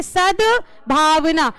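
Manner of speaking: सद्भावना very slowly, द then भ clearly apart; careful pronunciation. Shown as a demonstration. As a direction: draw out सद्भावना, sounding द before भ. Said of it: "पहले दा का उच्चारण होता है और बाद में भा का". (सद्भावना बहुत धीरे, पहले द फिर भ)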